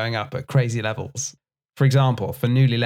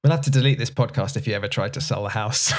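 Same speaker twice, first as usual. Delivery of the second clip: ordinary delivery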